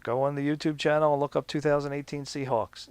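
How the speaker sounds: ordinary delivery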